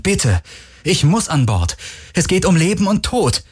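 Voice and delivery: high, urgent begging; desperate pleading. Acted. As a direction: beg as if life depends on it, pitch climbing. Fugitive begs captain to board.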